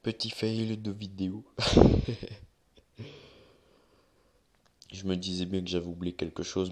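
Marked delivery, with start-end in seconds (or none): 1.5-2.4 burst of laughter. Real, not acted. Laughs at his own video fail.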